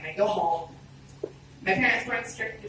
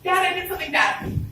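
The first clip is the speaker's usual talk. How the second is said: higher, pleading through tears; crying confession; 10 semitones higher, 4 dB louder than usual. Retold or imitated. Daughter confesses to her dad while crying. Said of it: "I'm crying like".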